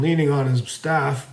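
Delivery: usual speaking voice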